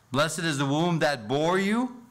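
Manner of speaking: a woman in the crowd blesses Jesus's mother, exclaimed; exclaimed quote. Shown as a demonstration. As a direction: call it out as she did from the crowd. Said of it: "notice the emphasis here".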